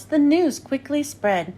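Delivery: plain reading voice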